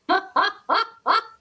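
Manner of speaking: high, loud staccato laugh; burst of laughter. Real, not acted. Player laughing at another's bad dice, high burst of laughter. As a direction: let out a sharp ha-ha-ha.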